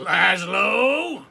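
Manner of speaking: loud, drawn-out spooky call of the name; eerie dark voice. Acted. The dark calling to Laszlo at night in a spooky voice. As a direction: call his name like a far-off ghost. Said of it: "the dark said, in the dark".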